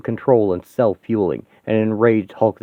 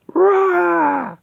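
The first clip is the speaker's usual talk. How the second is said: high, raw battle roar; rage roar. Acted. She-Hulk charges the robot with a roar. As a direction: let out a furious roar.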